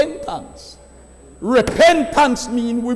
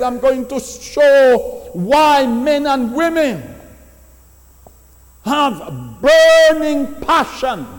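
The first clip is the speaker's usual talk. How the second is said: preaching; 3 semitones higher than usual. Acted. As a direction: lean on every word like a pulpit climax. Preacher building emphasis.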